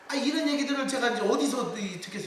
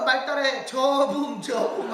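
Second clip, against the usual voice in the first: higher, louder Chungcheong coaching voice; Chungcheong dialect; 4 semitones higher, 5 dB louder, slower than usual. Retold or imitated. Grandmother coaching her grandson to repeat after her, Chungcheong dialect. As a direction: imitate a Chungcheong grandmother teaching a word. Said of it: "충청도 사투리로 애를 놓고".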